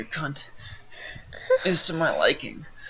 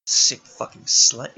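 slow, loud, contemptuous snarl; disgusted snarl; 3 semitones lower, 7 dB louder, slower than usual. Acted. Father turns on the captive with loud slow contempt. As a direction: spit the words out slowly in disgust.

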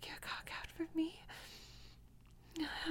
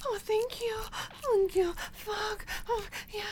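rising higher and louder, breathless cries; building to climax; 6 semitones higher, 3 dB louder than usual. Acted. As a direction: escalate from moans to cries. Mounting arousal to climax.

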